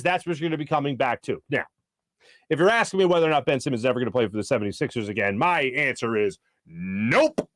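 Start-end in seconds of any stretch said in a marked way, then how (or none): 5.4-7.5 slow, low, final nope; confident drawn-out nope; emphatic nope. Real, not acted. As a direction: plant the word nope. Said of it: "A very emphatic, very confident nope".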